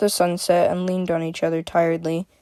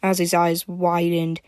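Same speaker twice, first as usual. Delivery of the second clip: ordinary delivery, nothing marked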